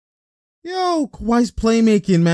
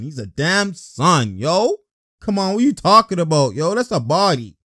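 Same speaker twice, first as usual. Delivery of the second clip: ordinary delivery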